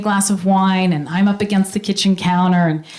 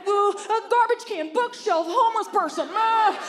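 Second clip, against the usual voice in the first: high, panicked pleading; panicked guy; 11 semitones higher, 7 dB quieter than usual. Retold or imitated. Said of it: "He's like".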